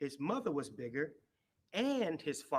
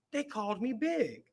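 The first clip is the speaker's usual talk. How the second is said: excited; 6 semitones higher, 5 dB louder than usual. A narrator's reading. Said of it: "exclaimed Little Hippo".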